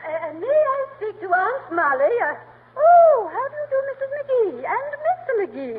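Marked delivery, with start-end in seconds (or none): 2.8-5.8 high, gushing grande dame greeting; gushing society lady. Acted. Society matron gushing at the McGees, high and affected. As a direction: coo it like a snobbish society matron.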